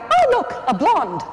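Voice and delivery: high, excited exclamation, then dropping; hammed-up "oh look" squeal; men's mock reaction. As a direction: imitate men suddenly distracted. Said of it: "the men all go".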